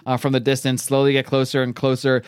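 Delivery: ordinary talk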